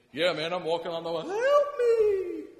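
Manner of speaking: breezy brag snapping into a shrieking high yell; swagger, then scream as you sink; panicked cry for help. Acted. Peter on the water suddenly sinking and shouting for help.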